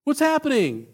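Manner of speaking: David moaning about his neighbour's blessing; whiny David. Retold or imitated. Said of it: "he's like".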